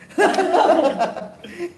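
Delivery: high bursting laugh; sudden loud laugh out; burst of laughter. Real, not acted. Laughs at the name.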